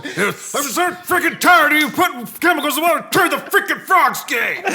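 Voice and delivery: high, loud, full-throttle conspiracy rant; outraged all-out tirade, yelled high; Alex Jones. Acted. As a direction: scream it like a ranting conspiracy host, dragging out the end. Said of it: "we might as well go full action".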